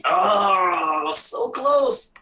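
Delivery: high, drawn-out groan of disappointment; real high-pitched frustration; agonized near miss. Real, not acted. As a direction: cry out at a narrow miss. Dice roll narrowly fails.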